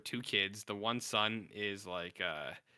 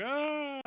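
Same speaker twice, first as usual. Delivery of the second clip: mock startled scream. Retold killer startled, runs away.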